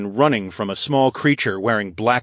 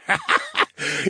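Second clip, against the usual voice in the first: high, breathy creature cackle; maniacal laugh. A narrator's reading. Wraith mocks the hero's sword, maniacal laugh. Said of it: "laughed maniacally".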